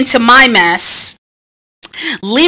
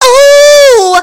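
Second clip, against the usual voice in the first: high loud hype cries; excited whoop; 13 semitones higher, 7 dB louder than usual. Real, not acted. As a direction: belt it out like a diva entrance. Hype opening, shouting into mic.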